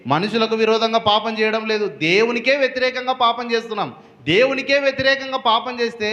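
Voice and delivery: lifted high preacher's cry against sin; preaching at full force. Acted. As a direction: drive the point home from the pulpit. Preaching climax.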